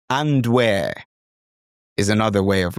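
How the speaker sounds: ordinary talk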